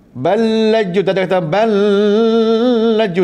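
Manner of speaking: ballaju with a separated nasal l, slowed; wrong reading. Shown as a demonstration. Teacher demonstrates the wrong ballaju to class. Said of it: "Dengung tak payah".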